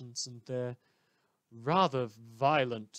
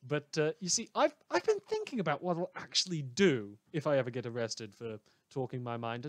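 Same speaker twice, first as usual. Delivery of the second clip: ordinary talk, nothing marked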